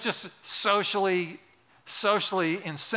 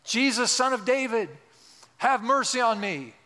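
raised, pleading call, acted out; higher-pitched mild yell; mock yelling; 5 semitones higher than usual. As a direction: cry out for mercy, pitched up, at half force. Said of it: "He starts yelling".

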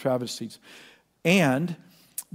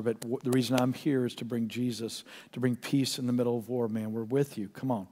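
put-on much softer, gentle comfort to a scared kid; soft reassurance; 3 semitones lower, 6 dB quieter than usual. Pastor comforts a terrified young soldier, soft and reassuring. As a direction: talk him down softly.

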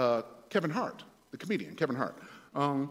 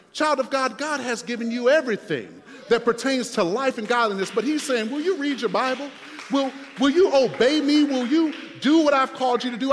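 loud, high, impassioned sermon climax; full-force preaching; 6 semitones higher, 8 dB louder than usual. Acted. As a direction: drive it home to the congregation, loud and emphatic.

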